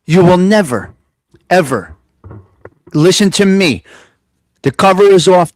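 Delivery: loud, forceful rant; shouting. Real, not acted. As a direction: hammer each word out loud. Ranting at a listener.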